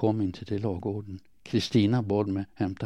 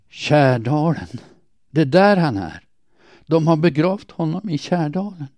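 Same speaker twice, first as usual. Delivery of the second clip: higher, louder exclamation; urgent outcry; 6 semitones higher, 6 dB louder than usual. A narrator's reading. Word slipping out in the kitchen about his burial, crying out. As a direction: cry out the dreadful discovery.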